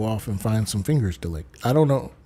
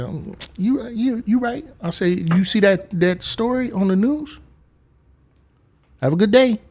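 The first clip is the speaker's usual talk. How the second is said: nervous appeasing; 8 semitones higher than usual. Retold or imitated.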